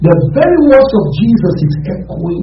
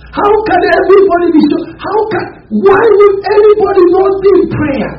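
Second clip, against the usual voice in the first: retold anguished prayer rising much higher to a crying shout; 14 semitones higher than usual. Preacher retelling his tearful prayer to God, anguished cry. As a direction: plead with God in tears, breaking upward. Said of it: "I was crying, saying".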